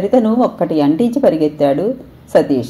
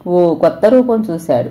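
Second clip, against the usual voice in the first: ordinary delivery, nothing marked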